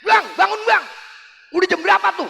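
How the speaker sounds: high-pitched scolding wife; nagging. Acted. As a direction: nag a sleeping husband awake. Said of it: "ada lagi ngomel". (istri ngomel membangunkan suami)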